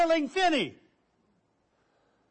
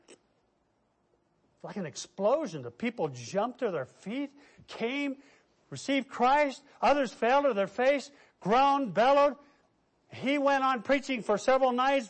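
usual speaking voice

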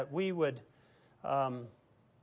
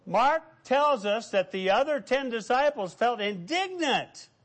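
ordinary talk

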